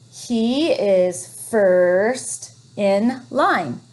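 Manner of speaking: He is first in line read very slowly; teacher modelling slow reading; slow reading aloud. Shown as a demonstration. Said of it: "modelling smooth reading".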